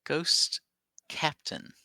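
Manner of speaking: ordinary talk, nothing marked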